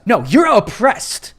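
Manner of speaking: a touch of preachy liberal; mock liberal insisting. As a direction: lean on it like a scolding know-it-all. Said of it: "It's like".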